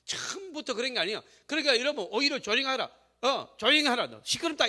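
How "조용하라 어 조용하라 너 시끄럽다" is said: a hint of an annoyed bystander, pitched up; mock scolding. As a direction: imitate a crowd hushing someone, higher and louder. Crowd scolding the blind man. (군중이 꾸짖는 흉내)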